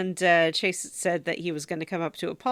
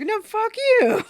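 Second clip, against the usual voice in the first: high, loud, slow hammed-up shout; drawn-out comic shouting match reply; mock yell back; 8 dB louder, slower than usual. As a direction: bellow it back at the neighbor. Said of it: "Robert yells back".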